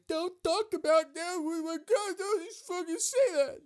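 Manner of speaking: very high, loud mock whine; mocking impression. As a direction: screech it as a ridiculed, offended boyfriend. Mocks the offended boyfriend's outburst.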